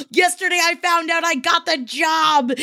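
sharp gasp, then high news; gasp, then announce the news high. Acted.

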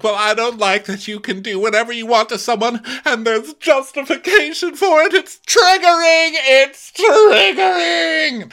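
hammed-up hysterical wail of triggering; screeching mock outrage rising to a peak; imitating Candace's meltdown. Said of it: "she's like".

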